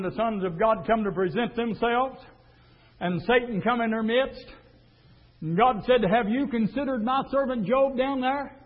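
ordinary talk

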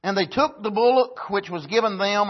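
usual speaking voice

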